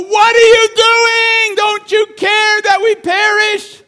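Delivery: high, loud, slow panicked shout; frantic disciples shouting. Retold or imitated. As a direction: cry out in the storm like terrified sailors. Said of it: "They go".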